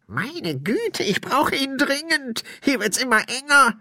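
high, put-on spirit voice, pressed; high-pitched ghostly grumble; pleading ghost. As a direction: beg urgently for a drink. Spirit begs for drink.

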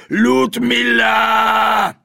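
long, drawn-out high shout; high, loud, stretched-out call. A narrator's reading. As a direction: summon someone from another room at full volume. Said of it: "rief sie".

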